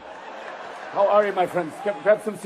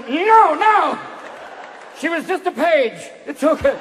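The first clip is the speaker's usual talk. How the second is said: put-on flustered old boss; 6 semitones higher, 5 dB louder, slower than usual. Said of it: "And he's going".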